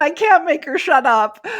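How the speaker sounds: loud, high-pitched laughing complaint; laughing exasperation. Real, not acted. Complaining she is stuck with her inner critic, laughing exasperation. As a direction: exclaim it through a big laugh.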